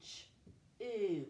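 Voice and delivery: CH as a lone consonant, then a held OO; sounded out. Shown as a demonstration. Teacher splitting a word into two sounds, sounded out. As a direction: break it into two separate sounds, CH and OO. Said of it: "Two sounds".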